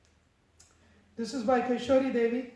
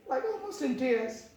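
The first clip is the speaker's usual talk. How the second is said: ordinary talk, nothing marked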